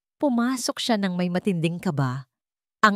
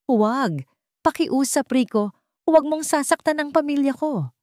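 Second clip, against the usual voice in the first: desperate plea; 9 semitones higher than usual. A narrator's reading. Ara pleading with Rico over the phone, high and desperate. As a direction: beg him to stop, voice rising in panic.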